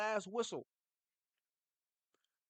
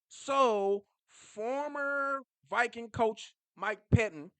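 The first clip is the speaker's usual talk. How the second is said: loud announcement; 4 semitones higher, 8 dB louder, much slower than usual. Real, not acted. Announcing the ex-coach by name.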